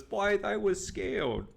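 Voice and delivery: put-on high, slow little-girl voice; a young girl admitting she was frightened. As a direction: say it like a shaken young child. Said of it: "she says".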